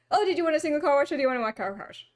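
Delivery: rapid-fire car wash pitch; imitating a rushed attendant; fast talker. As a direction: rattle off the options in one breath. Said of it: "talking so fast".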